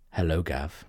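soft, low, soothing hello; calm greeting. Acted. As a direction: greet him gently and calmly. Robin voiced calmly.

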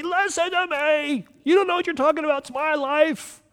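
high, shouted protest at a boss; frustrated outburst at someone in charge; mock yell. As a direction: yell it like nobody's listening. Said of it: "you just wanna yell at them".

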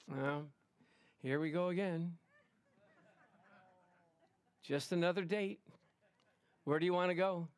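listless mumble with long pauses; dull, level, unenthusiastic delivery; bored date. Acted.

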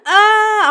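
high, loud wail of panic, retold; freaked-out scream. Retelling her panic at the dead squirrel, freaked-out scream. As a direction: cry out as if freaking out. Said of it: "I'm, like, freaking out".

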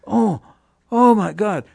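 high, loud cry of awe; mock crowd outcry. Audience recognizes the band behind the curtain, loud thrilled outcry. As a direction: imitate an overwhelmed audience crying out. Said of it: "They were yelling, screaming".

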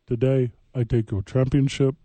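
ordinary talk

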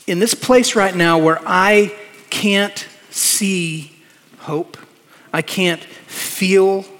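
slow, deliberate words with pauses between; slow, weighty paraphrase. Acted. Preacher voicing the psalmist's despair, slow and deliberate. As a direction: let each phrase sink in slowly.

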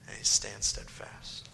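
slowly whispered with awe. Real, not acted. Preacher to congregation, a slow whisper. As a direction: breathe it out slowly.

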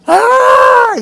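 high shrieking mock fan scream, loud; imitated fan scream. Preacher imitating screaming women fans at a stadium, mock shriek. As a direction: shriek like a fan seeing a star. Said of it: "las mujeres empezaron a gritar".

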